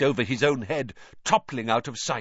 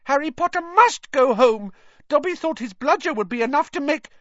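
high, loud squeaky insistence; urgent high-pitched elf; sudden urgent house-elf; 5 dB louder than usual. A narrator's reading. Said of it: "said suddenly".

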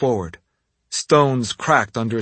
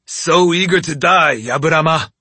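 loud savage taunt; 5 semitones higher, 5 dB louder than usual. Acted. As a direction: snarl the taunt with glee. Tatsumi taunts Oni.

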